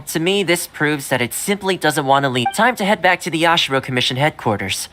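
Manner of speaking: normal talking voice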